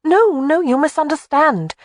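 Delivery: high, hurried no no; quick denial. A narrator's reading. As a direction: rush to correct him. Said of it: "Jamie said quickly".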